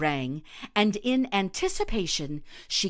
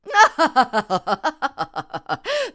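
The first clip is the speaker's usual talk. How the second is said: loud, high forced ironic laugh; ironic laughter; 8 semitones higher, 8 dB louder than usual. Acted. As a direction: laugh at him, mocking and put-on. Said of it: "the most ironic laughter she could manage".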